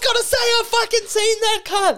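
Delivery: high, loud disbelieving yell; shocked shout. Real, not acted. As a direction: yell in stunned recognition. Shocked recognition of video.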